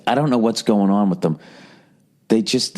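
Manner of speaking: ordinary talk, nothing marked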